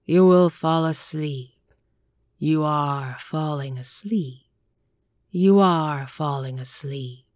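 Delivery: a hypnotist droning the subject into sleep; hypnotic command. A narrator's reading. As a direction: lull him under with each word.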